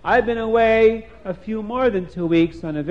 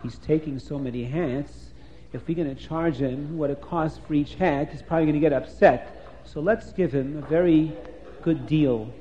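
hushed and much softer, a low whisper between two; 4 semitones lower, 6 dB quieter than usual. A narrator's reading. The mechanic and his wife whispering to each other, hushed and soft. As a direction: keep it down so the customer can't hear. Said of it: "are whispering to each other".

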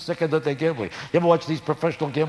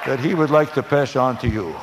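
lightly voiced Ed Sullivan. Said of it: "words of Ed Sullivan".

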